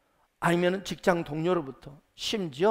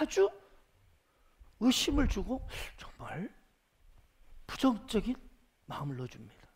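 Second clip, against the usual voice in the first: usual speaking voice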